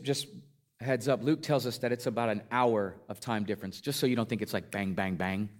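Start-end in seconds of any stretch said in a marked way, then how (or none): none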